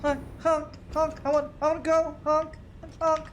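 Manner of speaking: high squeaky honking voice; silly clown. Pretend. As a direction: honk like an impatient toy. Voicing honking trains.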